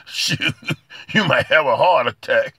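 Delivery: ordinary talk, nothing marked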